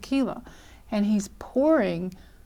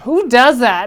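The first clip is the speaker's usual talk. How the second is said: louder, less high repeat of the disbelief; disbelieving; 3 semitones higher, 7 dB louder than usual. Retold or imitated. Law student wondering about the man: loud indignant disbelief. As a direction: press the question home with indignation.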